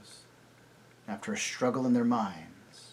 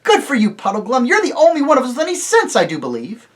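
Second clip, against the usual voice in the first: loud, high, fast praise; excited; 13 semitones higher, 13 dB louder, faster than usual. A narrator's reading. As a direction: cheer him on. Scrub praises Puddleglum loudly.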